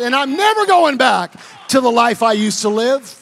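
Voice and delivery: loud, driving proclamation; fervent preaching. Real, not acted. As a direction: preach it full force. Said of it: "preaching his new identity".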